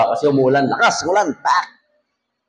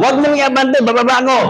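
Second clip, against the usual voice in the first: much higher hammed-up yell; mock yell; 9 semitones higher than usual. Passenger yelling at driver not to move forward, mock yell. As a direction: shout to stop the jeep, half-comic.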